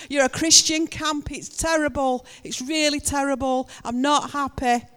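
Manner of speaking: loud, high, rapid-fire angry rant; acting out an angry neighbour's tirade; irate complaint. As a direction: reel off grievances at full volume, worked up. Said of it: "the calmness. That was just talking to someone and calming them down".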